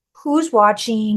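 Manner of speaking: ordinary delivery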